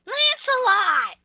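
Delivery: very high-pitched, exaggerated whine; mock whining. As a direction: whine it high, dragging out the last word. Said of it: "all she did was bitch and whine".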